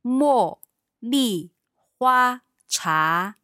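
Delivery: 茉莉花茶 said syllable by syllable, 茉 and 莉 falling fourth tones, 花 high level first tone, 茶 rising second tone; slow tone demo. Shown as a demonstration. Teacher repeats 茉莉花茶 slowly for the learner. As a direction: spell out each tone of 茉莉花茶 slowly and clearly. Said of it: "Let's listen again".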